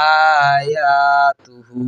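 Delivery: slow, stretched Quranic reading of আয়া; drawn-out recitation. Shown as a demonstration. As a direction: hold the vowels long in আয়া like tajweed recitation. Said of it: "demo of joined reading".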